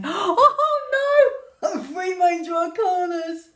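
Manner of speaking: gasping, high laughing cry; stunned by the cards, voice jumps up; real shock. Real, not acted. Tarot cards shock her.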